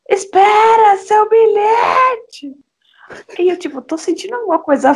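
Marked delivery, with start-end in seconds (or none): 0.1-2.5 shouting after someone. Retold or imitated. Woman shouting after girl about her ticket. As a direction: call out across the street. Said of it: "a moça saiu gritando".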